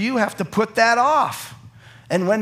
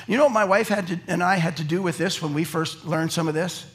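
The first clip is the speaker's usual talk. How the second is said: ordinary talk, nothing marked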